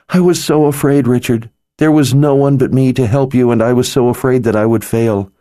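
high, urgent, near tears, rushing toward the end; tearful fear. A narrator's reading. Healer confessing her fear to Richard, near tears. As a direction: let the fear spill out as tears rise.

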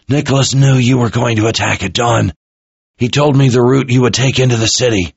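hurt officer giving up what he knows; frightened captive officer. A narrator's reading.

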